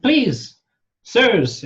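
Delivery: high, loud pleading kobold; little kobold begging sirs; squeaky kobold. Acted. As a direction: beg like a tiny creature. GM voices small kobold.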